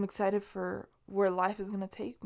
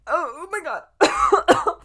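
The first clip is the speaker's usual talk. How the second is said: slow, stretched, louder and higher gasp of alarm; startled oh my God; 8 semitones higher, 9 dB louder, much slower than usual. Real, not acted. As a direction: caught off guard mid-choke, drawing it out. Chokes on her saliva.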